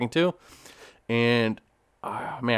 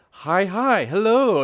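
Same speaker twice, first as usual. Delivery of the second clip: hi, hi, hello pushed louder and higher; louder confused hello; 6 semitones higher, 5 dB louder, much slower than usual. Retold or imitated. Stuck in a greeting loop, he calls a louder hello. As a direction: push the hello, baffled by the loop.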